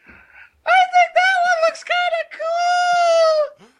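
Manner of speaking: very high, loud cartoonish squeal; Garrett impression. Friend mocking a buddy's liking for fursuits, squealed impression. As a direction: drag it out in a piercing mock falsetto. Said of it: "I gotta do my Garrett wi-, voice".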